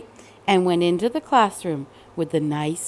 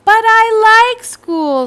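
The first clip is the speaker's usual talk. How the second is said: much higher, slow, loud whine; pleading little girl; 12 semitones higher, 9 dB louder, slower than usual. Acted. Cassandra insisting to her father, high slow whine. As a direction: drag it out like a stubborn kid. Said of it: "said Cassandra".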